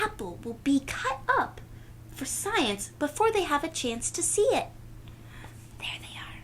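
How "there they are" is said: soft hushed whisper. Real, not acted. Storyteller whispering to the children. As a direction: whisper it like a secret. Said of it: "whispered aside".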